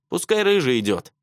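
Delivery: huffy, high-pitched refusal; scoffing boy's protest; snorting. A narrator's reading. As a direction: pass the job on indignantly. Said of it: "фыркнул Андрюха".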